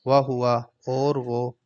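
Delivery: وہو stretched slowly, Arabic sounds; drawn-out Arabic. Shown as a demonstration. Teacher modelling وہو slowly for students. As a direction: say وہو long and slow. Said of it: "وہو slow".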